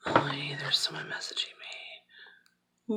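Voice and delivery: a real whisper under her breath; whispering off to the side; whispered aside. Real, not acted. Aside about an incoming message.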